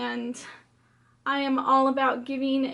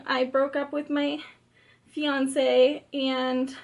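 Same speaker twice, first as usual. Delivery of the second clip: ordinary talk, nothing marked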